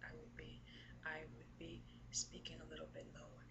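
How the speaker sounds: soft, low, hushed speaking. Shown as a demonstration. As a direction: drop down low and quiet. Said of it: "if I want to speak lower".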